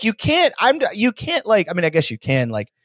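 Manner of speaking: usual speaking voice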